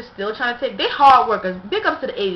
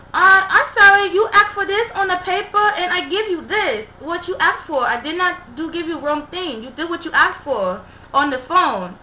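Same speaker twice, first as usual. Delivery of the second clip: higher, louder, with a pleading cry in it; the worker insisting she got the order right, higher and upset; imitated flustered worker; 7 semitones higher, 4 dB louder than usual. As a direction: plead your case, voice pitched up and strained. Restaurant worker defends herself.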